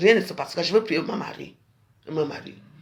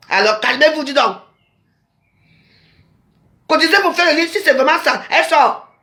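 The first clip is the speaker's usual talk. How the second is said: higher, louder fervent outburst; excited preaching; 7 semitones higher, 4 dB louder than usual. Real, not acted. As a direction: push it up with fervour. Heated address to audience. (harangue fervente, plus haute et plus forte)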